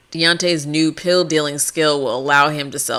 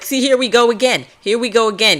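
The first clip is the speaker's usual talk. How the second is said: higher, fed-up here we go again; exasperated Loco; 7 semitones higher than usual. Acted. Loco scolding the slacking gang, higher and fed up. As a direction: sigh it out like he's heard it all before. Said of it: "He's like".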